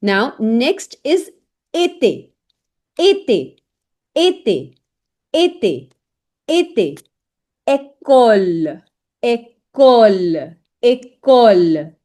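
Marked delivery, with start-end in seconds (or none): 1.7-7.0 été repeated as crisp "ay-tay"; model pronunciation. Shown as a demonstration. Teacher drilling the next example, été carefully as "ay-tay". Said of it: "you create a sound of A". 7.7-11.9 école very slow, "ay-koll" with a long second syllable; model pronunciation. Shown as a demonstration. Teacher drilling French learners, école slowly drawn out as "ay-kolll". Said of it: "you create a sound of A".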